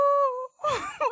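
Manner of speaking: high shrieking laugh. Real, not acted. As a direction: burst into a loud, high laugh. Loud laughing burst.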